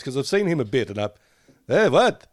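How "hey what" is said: put-on high, loud old man's "hey, what".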